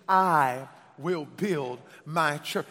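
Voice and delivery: loud, slow and low; emphatic call-and-repeat. Shown as a demonstration. Preacher has congregation say it again, slow and loud. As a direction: hammer out each word for the crowd.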